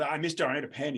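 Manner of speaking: put-on loud cheeky kid shouting for the teacher; calling out. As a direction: yell it across the classroom like a bored student. Student calling out in class.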